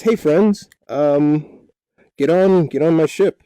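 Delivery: high, loud captain's call; rally the crew from the mast; acted hail to friends aboard.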